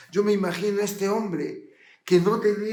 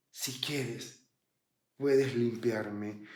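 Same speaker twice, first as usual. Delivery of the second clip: pleading leper; 7 semitones lower, 7 dB quieter, much slower than usual. Acted. Leper begging Jesus on his knees.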